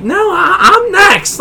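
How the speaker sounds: high-pitched mock indignation; acted-out high, indignant objection; indignant protest. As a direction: protest being skipped, pitch jumping up. Acts out protesting in line.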